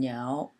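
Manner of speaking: niao in a low, dipping third tone. Shown as a demonstration. Teacher explaining woodpecker tones to learners, niao in third tone. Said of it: "is in the third tone".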